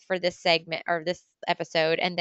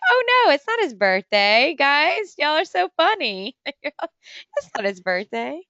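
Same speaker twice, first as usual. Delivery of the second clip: mock gullible self; 7 semitones higher, 4 dB louder than usual. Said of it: "And I'm like".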